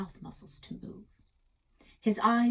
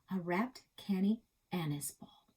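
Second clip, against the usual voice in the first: ordinary delivery, nothing marked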